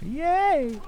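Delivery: high gleeful yay; excited cheer. Real, not acted. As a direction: cheer it out, delighted. Stabs guy from above.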